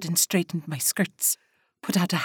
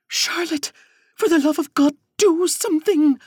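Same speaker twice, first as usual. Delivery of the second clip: high, loud frantic pleading; a terrified sister begging Charlotte; desperate plea; 10 semitones higher, 7 dB louder than usual. Acted. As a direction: beg her to act, frantic.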